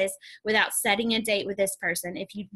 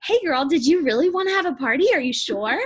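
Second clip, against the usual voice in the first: mock pushy ask; 5 semitones higher, 5 dB louder than usual. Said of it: "Notice I did not ask her".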